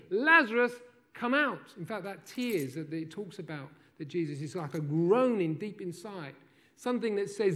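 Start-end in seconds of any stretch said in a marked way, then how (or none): 0.1-1.7 loud high call into the tomb; shouted command. Retold or imitated. Jesus calling dead friend from the tomb, shouting. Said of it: "And he shouts in". 6.8-7.6 loud, high, driving sermon delivery; preaching at full force. Acted. Preacher pressing the congregation toward the miracle, full force.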